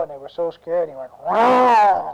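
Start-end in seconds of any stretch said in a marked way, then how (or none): 1.2-2.2 loud roar of a climbing bear. Acted. Storyteller plays bear coming up the tree, roaring.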